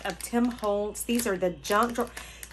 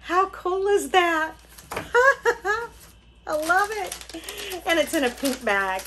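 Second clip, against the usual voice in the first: much higher and louder, laughing through it; giddy delight; 8 semitones higher, 4 dB louder than usual. Real, not acted. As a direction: giggle with glee. Laughing delight over the gift.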